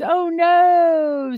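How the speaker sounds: sudden high, loud oh no; jolted by what chat wrote; a real startled cry; surprised oh no. Real, not acted. Reacting to a chat message.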